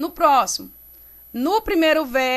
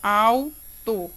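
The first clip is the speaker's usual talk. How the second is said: "au... tor" in two separate parts, lower; split into syllables; 4 semitones lower than usual. Shown as a demonstration. Teacher separating a word for students, "au", pause, "tor". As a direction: say "autor" as two detached syllables. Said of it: "Vamos separar".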